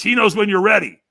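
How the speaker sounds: loud, high urgent command; imitated hype-man urging, rising to a shout; intense urging. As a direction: build to a shouted order. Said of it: "he whispers in my ear, and he says".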